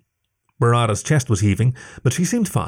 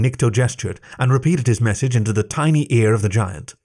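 ordinary delivery, nothing marked

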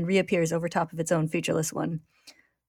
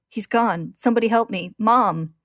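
calls growing higher and louder up to a shout of Mom; desperate cries; 4 semitones higher, 6 dB louder, slower than usual. Acted. Ed bleeding on the floor, crying for help. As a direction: call out for help in despair.

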